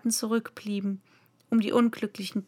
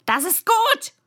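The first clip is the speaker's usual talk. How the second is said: very high, loud royal yell; shouting queen; 12 semitones higher, 7 dB louder than usual. A narrator's reading. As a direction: shriek approval at the soldiers. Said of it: "schrie die Königin".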